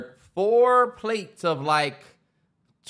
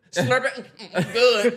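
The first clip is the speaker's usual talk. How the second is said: mocking slurping girl; 3 semitones higher, slower than usual. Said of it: "how fucking irritating she was".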